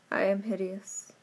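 ordinary delivery, nothing marked

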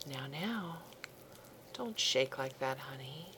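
ordinary talk, nothing marked

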